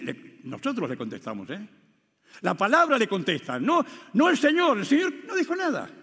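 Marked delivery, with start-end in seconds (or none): none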